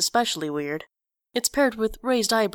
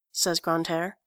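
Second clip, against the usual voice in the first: ordinary delivery, nothing marked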